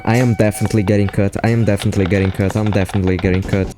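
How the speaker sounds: rapid, worried repeating; fast anxious muttering. Retold or imitated. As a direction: rattle it off nervously. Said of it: "thinking all the time".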